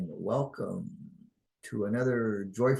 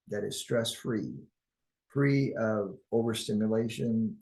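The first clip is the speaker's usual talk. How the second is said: calm, measured guiding voice with long pauses; guided meditation. Acted. Guide leading listeners into oneness and harmony, calm guided meditation. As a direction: lead them unhurriedly through the imagery.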